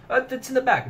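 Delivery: a hint of a bored comrade answering; comrade impression. Comrade answering about the towel, lightly voiced offhand. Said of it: "my impression of those two characters".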